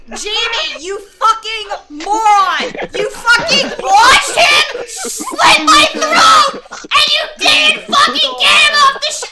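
high, loud furious shriek, laughing through it; raging scream. Real, not acted. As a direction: scream at Jamie, half-laughing, half-crying. Raging at Jamie over game.

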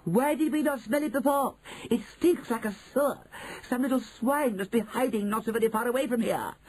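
high, indignant witch voice; clamouring witches. Acted. Witches hunting the hidden swine, high clamour. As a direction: cry it high in disgust.

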